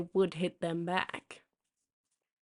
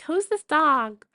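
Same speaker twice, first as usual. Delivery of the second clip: high, slow, whiny complaining woman; hammed-up high whine, dragging every word; mocking the dog owner; 8 semitones higher, 3 dB louder, slower than usual. Mimicking the dog-park owner who shushed her dog, high and slow.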